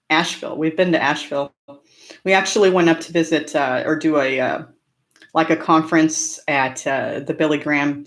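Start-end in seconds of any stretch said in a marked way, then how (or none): none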